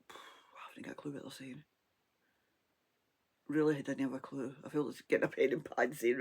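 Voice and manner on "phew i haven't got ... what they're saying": whisper. Retold or imitated. Can't hear at loud party.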